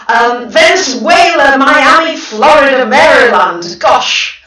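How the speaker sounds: excited host calling out places; excited greetings. Real, not acted. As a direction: greet each place with eager delight. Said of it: "not get too excited".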